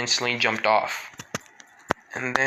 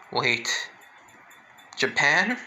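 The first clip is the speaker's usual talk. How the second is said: shocked; 2 semitones higher, much slower than usual. Retold or imitated. Toga hearing the scientist came from Japan, startled. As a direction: catch on the word in shock. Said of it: "Toga's eyes widen".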